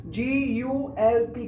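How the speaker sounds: letter by letter, slow; spelled out slowly. Shown as a demonstration. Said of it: "उसे बोलते हैं गल्प". (जी U L P धीरे धीरे बोलकर)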